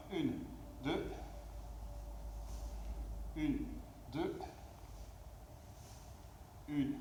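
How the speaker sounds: slow rhythmic rep count; workout rep counting. Real, not acted. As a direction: count the reps steadily. Coach counting hip extension reps.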